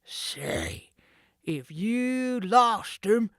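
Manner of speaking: slow, loud menacing voice; threat. Acted. Sid threatening Jake, menacing. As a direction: warn him darkly. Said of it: "did not finish the threat".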